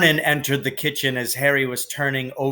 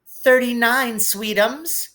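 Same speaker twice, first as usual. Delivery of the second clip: higher, sweet prompting voice; doting Aunt Petunia; 9 semitones higher, slower than usual. A narrator's reading. Mother finishes her son's sum, high doting coo. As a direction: coo the answer to her boy.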